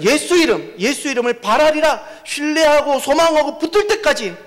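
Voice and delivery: high, loud, impassioned sermon cry; fervent pulpit shout; preaching at full force. Acted. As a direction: drive the point home to the congregation.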